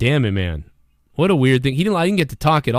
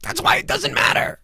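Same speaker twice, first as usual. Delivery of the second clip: mocking high voice; 14 semitones higher than usual.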